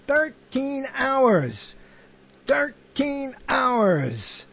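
slow, drawn-out count of the words; savoring the rhythm. Shown as a demonstration. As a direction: stretch each syllable to enjoy its beat. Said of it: "I like that rhythm".

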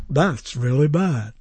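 slow, low, deadpan verdict; fake cart sound. Shown as a demonstration. Radio host analoging his sound cart, slow and low. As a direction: play back the canned line slowly. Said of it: "imitating the sound of a cart".

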